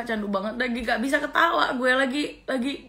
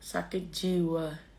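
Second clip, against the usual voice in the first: muttered; 6 semitones lower, 9 dB quieter, much slower than usual. Real, not acted. Muttered verdict.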